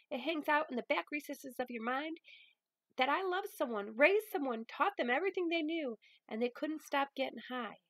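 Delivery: a grandmother setting her straight with love; stern loving Grams. Acted. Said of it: "I'm gonna probably use a little bit of voices".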